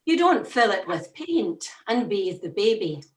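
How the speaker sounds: usual speaking voice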